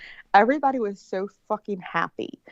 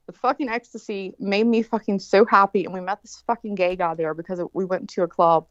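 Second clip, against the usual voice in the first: ordinary talk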